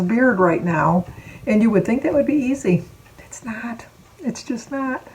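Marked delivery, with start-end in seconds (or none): 3.2-5.1 quiet aside. Real, not acted. Dropped soft aside.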